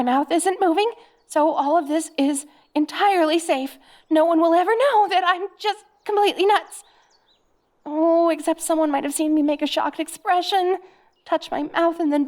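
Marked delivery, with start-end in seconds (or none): none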